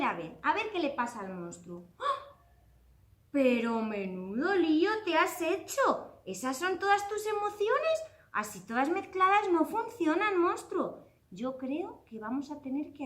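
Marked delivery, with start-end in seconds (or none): 2.0-2.4 sorpresa. Acted. Monstruo aparece, sorpresa. 3.3-11.1 asombro. Acted. Riñe al monstruo desordenado.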